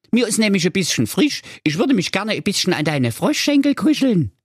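high frog voice, coaxing; wheedling frog. Acted. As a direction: sweet-talk your way into the bed.

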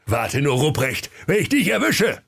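threatening cry climbing high; hammed-up high-pitched warning; Weihnachtsmann. A narrator's reading. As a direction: threaten indignantly, jumping up in pitch. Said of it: "Der Weihnachtsmann sprach".

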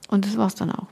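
usual speaking voice

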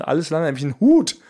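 ordinary talk

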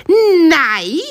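frightened bunny cry. Acted. Bunny refuses to be eaten.

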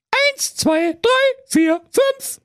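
very high piping count; little goats counting off; kids counting. A narrator's reading.

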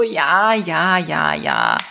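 mildly annoyed ja ja ja; annoyed reply. Retold or imitated. [name] answers her inner voice, mildly annoyed brush-off. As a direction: brush it off, a bit fed up. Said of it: "sag ich genervt zurück".

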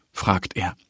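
ordinary delivery, nothing marked

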